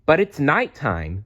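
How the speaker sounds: high little squeak. Acted. Stella Luna to Mother Bat, anxious high squeak. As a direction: squeak it out nervously. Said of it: "Stella Luna squeaked".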